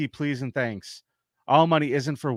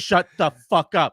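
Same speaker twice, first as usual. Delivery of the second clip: louder, higher final shutdown; talking over someone; 5 semitones higher, 7 dB louder than usual. Retold or imitated. Comic drowning out a heckler, loud imitated shutdown. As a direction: cap the imitation with each word punched out. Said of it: "he just talked over her".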